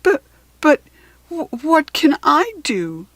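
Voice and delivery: stammering, high, tearful voice; crying crocodile. A narrator's reading. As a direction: sob out the words, stammering. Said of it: "The crocodile began to cry too".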